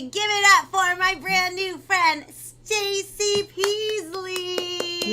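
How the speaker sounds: loud, drawn-out hype announcement; announcer voice. Acted. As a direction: bring the guest on like a game show host. Hyping the guest's arrival.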